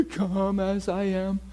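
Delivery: exaggerated fake sobbing, slowly drawn out; mock crying. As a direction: blubber the words in a parody of weepy repentance. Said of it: "If it's just about crying".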